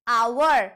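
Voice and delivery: "आवर" opening on the vowel, H dropped; silent H. Shown as a demonstration. Teacher correcting the mistake, "आवर" with the H silent. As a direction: say "आवर" with no H at the start. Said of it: "तो एच silent होता है".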